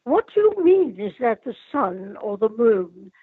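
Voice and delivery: put-on haughty upper-class British accent, pitch swooping high; highbrow British Duchess. Performer showing children her favourite character, highbrow British Duchess accent. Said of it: "is the highbrow British Duchess".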